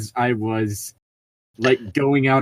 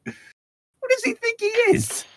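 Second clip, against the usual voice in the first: much higher, mocking outraged squeak; indignant thugs; faster than usual. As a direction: play the offended brutes as a cartoonish whine. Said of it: "they ask".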